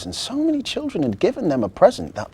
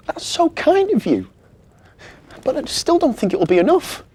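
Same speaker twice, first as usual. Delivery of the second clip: very high, louder squeaky elf; a tiny tearful elf, pitched way up; 8 dB louder than usual. Acted. Tearful elf Marzi thanks the children, very high.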